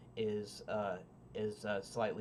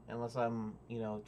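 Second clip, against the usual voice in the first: ordinary talk, nothing marked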